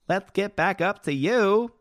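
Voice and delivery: drawn-out sing-song call; playful sing-song. Pretend. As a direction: coax the scrolling chat back like a lost pet. Chat scrolled away, calls after it.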